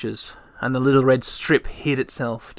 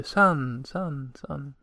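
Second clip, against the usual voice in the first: each "sun" softer till it dies away; fading echo; 2 semitones higher, 7 dB quieter, much slower than usual. A narrator's reading. The echo carries Moomintroll's word away, fading out. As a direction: let the echo fade off into the dark. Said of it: "further and further off, weaker and weaker".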